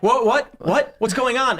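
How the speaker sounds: high, flustered robot confusion; mock panic. Robot waking in a strange kitchen, high and flustered. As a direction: sputter like a just-booted robot. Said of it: "you're like".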